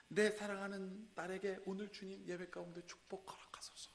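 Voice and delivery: hushed prayer; whispered prayer. Acted. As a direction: pray softly in a whisper. Parent's whispered prayer voiced.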